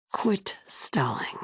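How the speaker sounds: slow, impatient whisper; irritated whispered aside. Acted. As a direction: hiss it under your breath, slowly.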